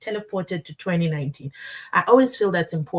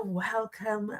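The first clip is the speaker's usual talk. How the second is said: normal talking voice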